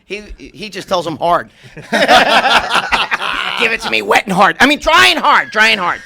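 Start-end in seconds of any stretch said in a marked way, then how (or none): 1.7-4.6 imitating a trainer. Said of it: "I tell these guys dry and hard". 4.6-6.0 correcting the imitation. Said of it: "I tell these guys dry and hard".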